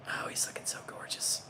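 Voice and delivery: hushed admiring whisper; soft whispered praise. Real, not acted. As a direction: breathe it out in awe. Admiring the painted tree.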